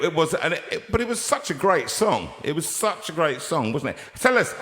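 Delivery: normal talking voice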